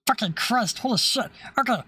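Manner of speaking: ordinary talk, nothing marked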